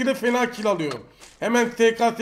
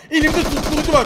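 louder, insistent repeat with var falling; 5 semitones higher, 5 dB louder than usual. Real, not acted. Streamer repeating to the enemy, louder and insistent. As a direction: say it again and press harder on it.

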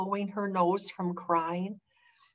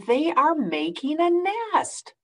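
higher, bright Piggy explaining; 9 semitones higher than usual. Acted. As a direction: tell him gently, pitched up.

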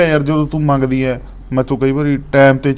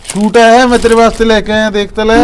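higher, louder, coaxing pitch; eager; 5 semitones higher, 5 dB louder than usual. Acted. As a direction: show off the gift, hoping to please. Husband eagerly shows a gift. (ਚਾਅ ਨਾਲ ਤੋਹਫ਼ਾ ਦਿਖਾਉਣਾ)

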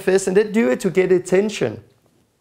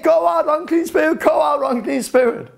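imitated shout; 8 semitones higher than usual. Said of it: "So she started to shake".

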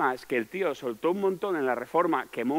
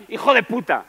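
louder, higher mock insult; angry outburst; 8 semitones higher, 7 dB louder than usual. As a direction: snap it out in mock fury. Retold angry outburst at waiter.

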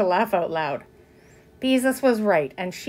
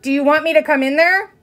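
raised, loud parental threat; warning; 6 semitones higher, 6 dB louder than usual. A narrator's reading. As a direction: warn the child you may step in. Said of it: "voice held a warning note".

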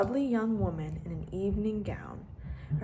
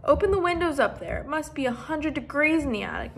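higher, louder call upstairs; 6 semitones higher, 6 dB louder than usual. Acted. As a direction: call up the stairs to the children. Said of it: "called up after them".

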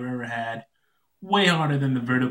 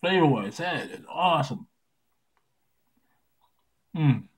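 talking around a lollipop; candy in mouth; 2 semitones higher than usual. Real, not acted. Seen 3: Lollipop stick visible in mouth throughout the labelled words.

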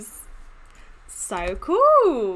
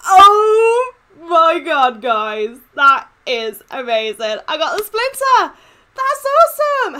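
ecstatic shout; 3 semitones higher, 10 dB louder, slower than usual. Real, not acted. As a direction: shout it out in sheer joy. Gets wanted skin, gasps.

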